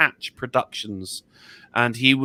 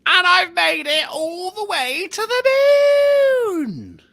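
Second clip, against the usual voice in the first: high, slow, yelled victory cry; drawn-out cheer, very high and loud; triumphant shout; 13 semitones higher, 5 dB louder, much slower than usual. Acted. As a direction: bellow it like a conquering hero. Triumphant arrival on the moon.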